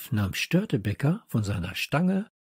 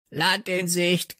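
higher, slow squawked "Land in Sicht"; croaking parrot; 6 semitones higher than usual. A narrator's reading. Parrot announces land, slow high croak. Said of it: "krächzte Störtebeker".

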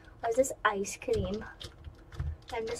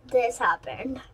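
normal talking voice

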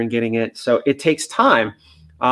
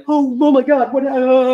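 higher, alarmed yelp of oh my God; startled dog; 12 semitones higher, 4 dB louder than usual. Pretend. A fearful dog startled by its owner's loud yes, alarmed. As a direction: jump as if a bomb just went off.

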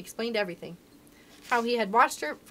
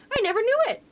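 high, louder burst of surprise; astonished; 11 semitones higher, 6 dB louder, faster than usual. A narrator's reading. Princess learning her friend was in her house, astonished. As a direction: exclaim it with the pitch leaping up.

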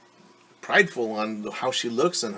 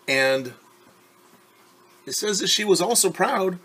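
ordinary delivery

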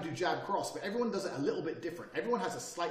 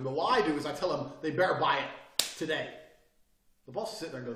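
ordinary talk, nothing marked

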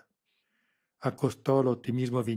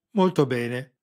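satisfied; 4 semitones higher, 11 dB louder, slower than usual. A narrator's reading. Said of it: "commentò Sarrese".

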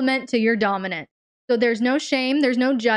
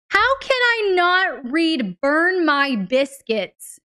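drawn-out Southern accent, slow and higher; 5 semitones higher, much slower than usual. Shown as a demonstration. Amused host slips into Southern accent while reading. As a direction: savor the Southern twang on biscuits. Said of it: "How can I not read that in a Southern accent?".

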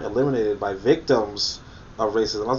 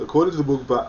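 mock self-citation; 3 semitones higher, much faster than usual. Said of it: "while I'm writing the Book of Power".

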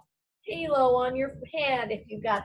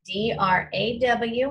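ordinary delivery

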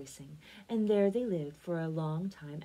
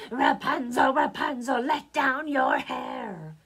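loud, higher call up a tower; 5 semitones higher, 10 dB louder, slower than usual. A narrator's reading. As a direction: witch calling up, drawing out the last words. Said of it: "come to the tower and call out".

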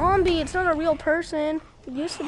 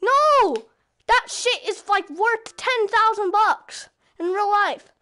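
exasperated shout; 5 semitones higher, 5 dB louder, slower than usual. Real, not acted. Still ranting about loot.

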